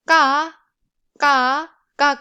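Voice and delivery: "ka" with a hard glottal k, no puff; tense Korean k in "ka". Shown as a demonstration. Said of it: "this one is tense sound".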